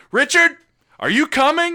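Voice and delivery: high, loud retold call. Calling his friend at the register, loud mock yell. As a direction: imitate yelling over to a friend.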